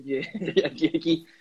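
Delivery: half-laughing, stammering mock panic; nervous giggly stammer, not real fear; mock scared. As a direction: laugh off the fear of being arrested. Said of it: "uno como que se asusta".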